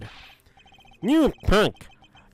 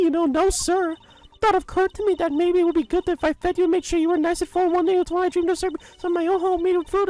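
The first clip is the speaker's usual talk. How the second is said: much higher, fussy granny voice fawning over Edgy Poo; doting old lady. Acted. Old lady fawning over Edgeworth with her gift, high doting granny voice. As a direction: gush like a lovestruck old busybody.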